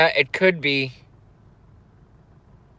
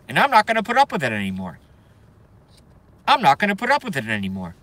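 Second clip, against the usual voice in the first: fast rant; much faster than usual. Real, not acted.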